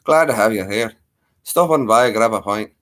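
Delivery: welcoming Irish pub brogue; hammed-up Irish lilt; Irish accent. Shown as a demonstration. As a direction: greet them in a put-on Irish accent. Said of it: "a wee little bit of Ireland".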